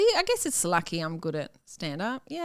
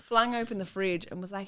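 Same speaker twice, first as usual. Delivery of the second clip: usual speaking voice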